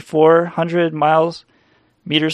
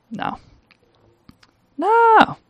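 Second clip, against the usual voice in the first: a much higher, squealing No; mock shriek. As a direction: squeal the No way up high. Mock shrill denial.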